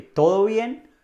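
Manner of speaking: "¿Todo bien?" as a loud, high rising question; questioning tune. Acted. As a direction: check on a friend with a climbing "¿Todo bien?". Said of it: "se usa más como pregunta". ("¿Todo bien?" como pregunta, subiendo el tono)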